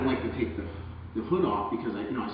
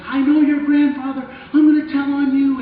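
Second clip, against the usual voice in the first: crying shout; 11 dB louder than usual. Retold or imitated. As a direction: scream it through tears, raised high. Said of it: "She's crying".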